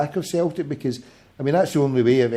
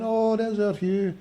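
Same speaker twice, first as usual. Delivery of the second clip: sister-in-law quoted; 8 semitones higher, 4 dB quieter, slower than usual. Retold or imitated. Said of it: "she went".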